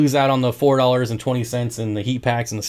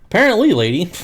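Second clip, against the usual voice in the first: sarcastic retort; 6 semitones higher, 3 dB louder, faster than usual. Retold or imitated. Sarcastic comeback to the customer, high and loud. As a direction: toss back a dry sarcastic jab.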